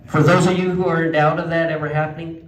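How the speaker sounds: ordinary delivery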